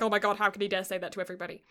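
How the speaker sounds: rushed, fast flustered inner voice; inner panic. Retold or imitated. Yamaken panics inside after being outed, rushing. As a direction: blurt out his hidden panic quickly. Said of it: "inside he's like".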